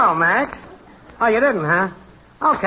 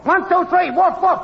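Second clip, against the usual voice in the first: high-pitched chanted yell; woof-woofing test yell; 8 semitones higher than usual. Acted. Technician testing whether they are on air, high yelling. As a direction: call the mic test out high, in punched barks. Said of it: "woof woofing and yelling".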